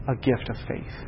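near-whispered gift of faith; whispered preacher's point; hushed. Real, not acted. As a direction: drop to a breathy hush. Sermon hushed aside.

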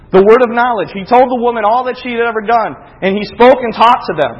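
brief fervent preaching; preaching lift. Real, not acted. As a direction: lift it like a pulpit point. Raised preaching burst.

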